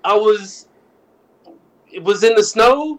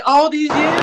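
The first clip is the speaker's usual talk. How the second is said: mock betrayal; 9 semitones higher than usual. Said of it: "I was like".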